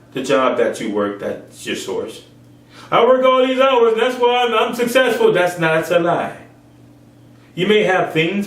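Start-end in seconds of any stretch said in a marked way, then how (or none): 2.9-5.3 mock workaholic. Voicing a proud workaholic.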